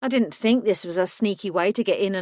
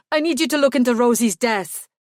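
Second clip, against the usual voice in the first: louder, urgent outburst; bursting out; 5 semitones higher, 6 dB louder than usual. A narrator's reading. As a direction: blurt it out in desperation. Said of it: "he burst out".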